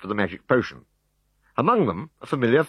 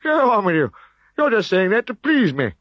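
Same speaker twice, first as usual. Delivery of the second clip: high, louder scoffing voice; high loud doubting old chief; sceptical chief; 13 semitones higher, 5 dB louder than usual. A narrator's reading. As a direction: wave off the news as nonsense. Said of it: "said the sceptical Vital Statistics".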